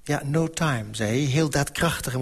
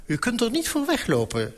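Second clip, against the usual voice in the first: calling after someone; 9 semitones higher than usual. A narrator's reading. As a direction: mildly call after him as he leaves. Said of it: "riep Servandoni me na". (ingehouden naroepen, hoog van toon)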